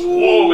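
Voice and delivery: high, loud whoosh of a whoa; imitated swooping whoa. Showing friends a dance wave, high swooping whoa.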